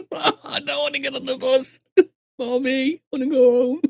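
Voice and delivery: high, whining fake crying; mock tearful child. Passenger begging Mommy on the bus, mock tearful. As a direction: wail like a frightened kid, hammed-up.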